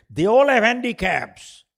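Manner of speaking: slow, loud, high on all; emphatic punch. Retold or imitated. Boss insisting professionals are stuck, slow loud emphasis. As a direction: hammer home that everyone is handicapped.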